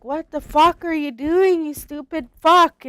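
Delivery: high, loud horrified shout; uncle's shocked yell. Retold or imitated. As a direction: yell it in alarm as if finding someone hanging. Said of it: "he's like".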